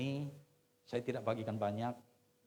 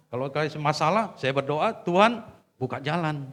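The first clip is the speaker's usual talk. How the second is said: rising louder through the list; building emphasis; 5 semitones higher, 9 dB louder than usual. Retold or imitated. Pastor telling the young wife about prayer, growing louder. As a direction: build from steady to forceful, line by line. Preacher's quoted testimony, building.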